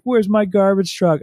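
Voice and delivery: high-pitched mock panic of a small child; imitated freak-out. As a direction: imitate a kid's frantic demand. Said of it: "he's, like, freaking out again".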